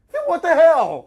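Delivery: shocked outburst. Acted. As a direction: blurt it out in disbelief. Startled by dead friend's voice.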